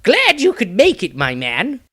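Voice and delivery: cheery, cocky greeting; silly grin. A narrator's reading. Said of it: "a silly grin on his face as he spoke".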